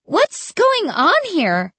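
high-pitched, bewildered question; amazed cry. A narrator's reading. Narrator watching scarecrows jump back, amazed cry. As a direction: cry out in astonishment. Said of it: "I cried".

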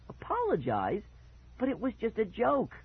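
high, protesting whine; complaining. A narrator's reading. Brother told to apologize for prank, complaining. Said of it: "Mickey complained".

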